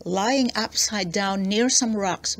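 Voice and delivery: ordinary delivery, nothing marked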